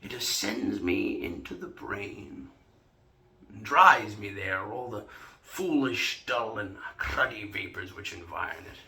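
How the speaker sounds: measured, theatrical tippler's oration; jovial Shakespearean drinker. Acted. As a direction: savour the verbose argument, slowing on key phrases. Performs King Henry IV Part Two monologue.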